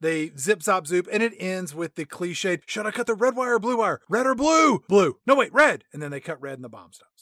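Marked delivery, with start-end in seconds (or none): 2.7-4.8 high, frantic hammed-up dilemma; mock panic. Acted cliched bomb-defusing dilemma. 4.9-5.8 loud, hammed-up snap decision; mock panic. Acted last-second choice of wire.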